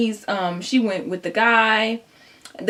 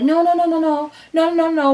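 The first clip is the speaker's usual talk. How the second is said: high, loud frantic no's; fleeing with suitcases, frantic no; panicked refusal; 7 semitones higher, 4 dB louder than usual. Retold or imitated. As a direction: wave them off in mock panic. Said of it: "And I'm just like".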